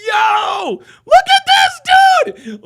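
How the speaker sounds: high, loud, drawn-out shout; hilarious disbelief. Real, not acted. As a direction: cry out slowly at the top of your voice. Cracking up at video clip.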